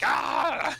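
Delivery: high, strained villain yelp; angry yelp. Retold or imitated. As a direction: let out a cartoon villain's outraged cry. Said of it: "Robotnik really gets pissed off".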